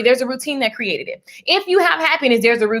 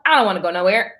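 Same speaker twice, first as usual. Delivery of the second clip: dropped lower, dismissive about-face; mock refusal; 5 semitones lower, faster than usual. Acting out flip-flop energy, mock low refusal. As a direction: drop down for the contrary side.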